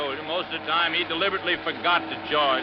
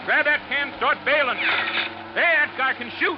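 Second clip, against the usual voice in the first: higher, hurried shouts in danger; urgent calling out; 8 semitones higher, slower than usual. Acted. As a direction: yell the order to bail. Boat taking water under fire.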